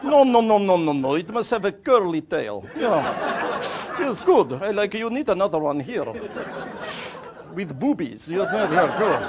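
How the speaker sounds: high, loud, eager official; Bulgarian harbour master. Acted. Official fussing over traveller's drawing, excitedly insistent. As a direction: get carried away directing the drawing. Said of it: "And then he went".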